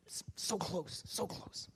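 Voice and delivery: soft unvoiced whisper; whispered regret. Comedian missing his chance, whispering in defeat. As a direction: breathe it out, crushed. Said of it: "whispered disappointment".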